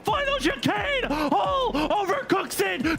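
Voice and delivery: rising shouted race call; excited commentary. Real, not acted. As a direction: call the finish at full excitement. Live race finish call.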